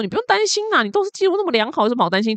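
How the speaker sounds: high-pitched, breezy imitation of dad; dad reassuring. Dad telling daughter not to worry, high breezy imitation. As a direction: play the carefree father brushing off worry. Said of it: "所以我爸说".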